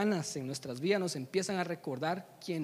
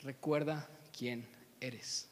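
very slow, low and soft; weighty, spaced words from a father above; solemn film quote; 5 semitones lower, 7 dB quieter, much slower than usual. Acted. Mufasa to his son, slow, low, solemn. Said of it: "la famosa frase del Rey León".

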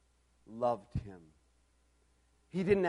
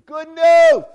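very loud, high cry; jubilant shout; 12 semitones higher, 22 dB louder than usual. Acted. Preacher proclaiming Bernie's message to congregation, jubilant shout. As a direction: burst out with it at full voice.

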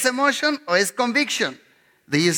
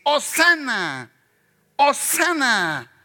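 high, slow jubilant hosanna; hosanna cry; 5 semitones higher, much slower than usual. Retold or imitated. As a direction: call it out to the crowd, stretched. Crowd shouting hosanna.

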